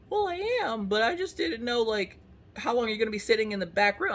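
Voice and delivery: silly high-pitched husband parody; mock husband. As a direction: poke fun at him in a squeaky voice. Voicing husband's defense.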